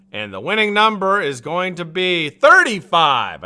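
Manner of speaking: slow, loud winner announcement; raffle caller. Acted. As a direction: draw it out like a raffle host. Announcing raffle winning number.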